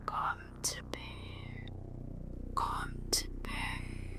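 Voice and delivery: very slow, faint whisper; weak, ghostly whispered plea. A narrator's reading. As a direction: whisper it faintly, drawing out each word. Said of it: "den hviskende stemme".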